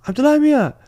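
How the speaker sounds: high, loud mock old grandma calling out; imitating grandma. Retelling how grandma asked about her in a mock high voice. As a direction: squeal it like a scandalised grandmother.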